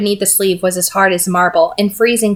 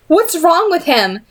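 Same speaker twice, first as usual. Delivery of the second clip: wretched demand; 9 semitones higher, slower than usual. A narrator's reading. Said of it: "Tessa demanded wretchedly".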